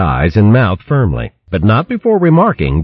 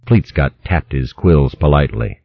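ordinary delivery, nothing marked

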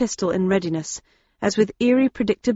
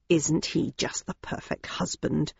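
soft, slow and sarcastic; sarcastic murmur; 4 semitones lower, 9 dB quieter, slower than usual. A narrator's reading. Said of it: "murmured Mother Daisy sarcastically".

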